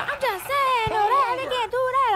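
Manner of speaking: very high mock-protesting voice; hammed-up high-pitched objection; imitated woman protesting. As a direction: imitate a worked-up woman defending herself. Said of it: "She's like".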